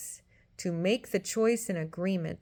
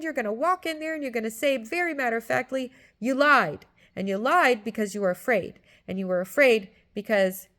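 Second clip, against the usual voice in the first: louder insistent scolding; stern conscience; 4 semitones higher, 6 dB louder than usual. Retold or imitated. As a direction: keep pressing the point hard. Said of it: "it's screaming at me".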